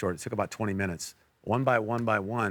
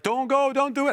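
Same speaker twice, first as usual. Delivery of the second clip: high, loud pleading voice of the translator; alarmed translator; 5 dB louder than usual. Retold or imitated. Translator urgently begging the speaker not to walk up, high and loud. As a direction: pitch it way up and beg him not to go. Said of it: "My, my translator's like".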